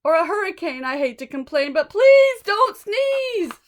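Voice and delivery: high, loud pleading creature; pleading buffalo. Acted. Buffalo pleading with the elephant, high and loud. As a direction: beg him breathlessly in rhyme.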